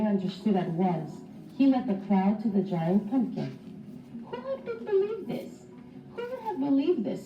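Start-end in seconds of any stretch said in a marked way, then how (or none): 4.2-7.2 high, soft, wondering mouse voice; lightly voiced astonished mouse; amazed mouse voice. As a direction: marvel at it like a tiny mouse. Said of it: "said Desmond at the same moment".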